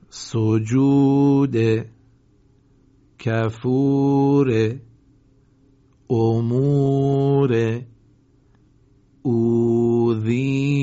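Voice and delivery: very slow, loud, every long vowel stretched; long vowels held. Shown as a demonstration. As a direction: draw each long vowel out for the class. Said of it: "بعد از من تکرار بفرمایید".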